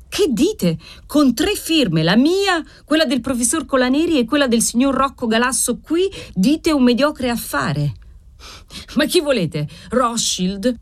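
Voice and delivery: high-pitched, incredulous protest; indignant disbelief. A narrator's reading. Client protesting to the moneylender, indignantly incredulous.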